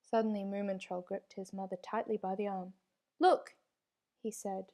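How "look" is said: high, louder startled cry of a small troll; frightened cry. A narrator's reading. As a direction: blurt it out in sudden fright. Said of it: "so frightened that his tail stuck straight out".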